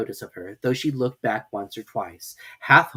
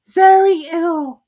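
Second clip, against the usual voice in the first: high and very slow; drawn-out squeaky mouse; Dormouse voice; 13 dB louder, much slower than usual. Acted. Dormouse answers.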